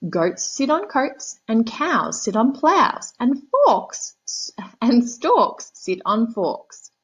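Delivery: high, slow know-it-all cat voice; bossy cat. Acted. Cat lecturing the frog on rhymes, high slow smug voice.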